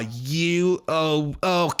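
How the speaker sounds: drunken sneer breaking into a fake laugh; mock drunk taunting. Drunk wife scoffing at her husband, mocking. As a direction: imitate a drunk, derisive ex. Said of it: "she's drunk and she's all".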